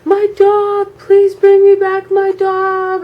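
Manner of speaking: high, loud, drawn-out mock wail; acted-out tearful yell; screaming and crying, retold. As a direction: wail it like a desperate kid chasing after someone. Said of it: "I'm screaming and I'm crying".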